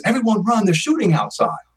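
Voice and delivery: high-pitched mock alarm, easing down at the end; urgent retold call; retold warning. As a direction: imitate a panicked warning to a crowd at ordinary volume. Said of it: "something like this".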